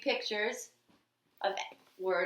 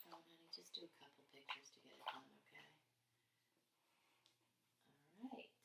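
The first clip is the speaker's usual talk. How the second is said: hushed aside; 5 semitones lower, 26 dB quieter, much faster than usual. Real, not acted. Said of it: "aside to her child".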